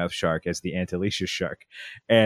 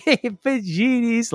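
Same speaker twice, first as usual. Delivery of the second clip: hey pajinis pitched up high and slow; high toddler voice; slower than usual. Retold or imitated. Dad imitating his son answering the pajinis joke, high and slow. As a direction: mimic a giggly little kid.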